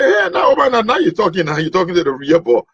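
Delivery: put-on excited boy. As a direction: jump at the chance, thrilled. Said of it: "He said".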